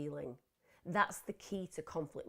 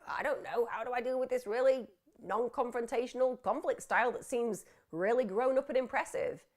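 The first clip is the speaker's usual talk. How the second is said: higher-pitched flustered imitation; hammed-up fluster, pitched up and louder; mock panic; 6 semitones higher, 4 dB louder than usual. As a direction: imitate someone thrown into a panic, voice raised. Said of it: "It scares to death the people".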